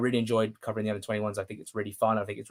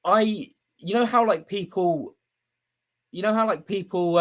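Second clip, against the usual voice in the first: higher, voice catching with real emotion; strained, higher, really moved; choked up; 7 semitones higher than usual. Real, not acted. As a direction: fight back tears mid-sentence. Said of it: "I'm getting really emotional".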